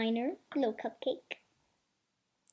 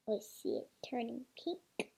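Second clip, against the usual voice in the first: soft breathless whisper; 3 semitones lower, 11 dB quieter than usual. Real, not acted. Watching the egg turn pink, hushed whisper. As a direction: whisper as if not to disturb it.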